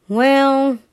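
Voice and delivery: stall, unsure about letting him; doubtful well. A narrator's reading. Brother unsure about letting Billy help, doubtful. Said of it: "said Brother doubtfully".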